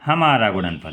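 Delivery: usual speaking voice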